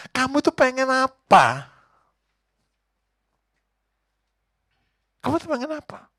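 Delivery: God asks the blind man what he wants, loud and high; God asking. Acted. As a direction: call down the question as God. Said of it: "Tuhan itu bilang".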